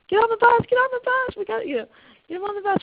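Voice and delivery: put-on high, loud call to hurry the kids; frantic calling out. Camp director hurrying crying kids onto the bus, high loud call. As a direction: shout them onto the bus in a rush.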